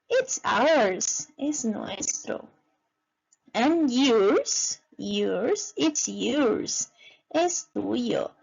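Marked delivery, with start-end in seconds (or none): none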